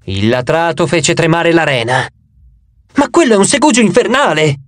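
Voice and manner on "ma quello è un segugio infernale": higher-pitched narrated cry of alarm; mild yell. A narrator's reading. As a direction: exclaim it higher, without real shouting. (grido accennato, più acuto)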